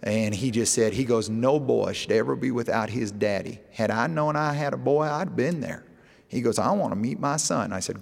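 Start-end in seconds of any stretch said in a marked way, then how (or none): 1.3-5.8 imitating a North Carolina dad's twang, lifting in pitch; retold in a North Carolina twang. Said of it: "he's got a twang. He just talked like this". 6.6-7.7 higher-pitched imitation of a North Carolina twang. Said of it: "he's got a twang".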